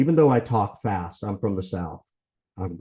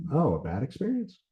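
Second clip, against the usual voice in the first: "a bad experience" echoed higher, rising at the end; mirroring question; 5 semitones higher, 3 dB quieter than usual. Shown as a demonstration. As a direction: repeat "a bad experience" back as a questioning prompt. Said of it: "rephrasing it as a question".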